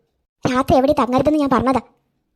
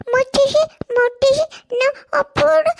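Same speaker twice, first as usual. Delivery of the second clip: put-on much higher toddler voice babbling; baby talk; 11 semitones higher, slower than usual. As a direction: babble like a small child to grandma. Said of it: "നീ എന്താടി കൊഞ്ചി പറയുന്നേ". (കൊഞ്ചി പറയുന്ന കുഞ്ഞ്)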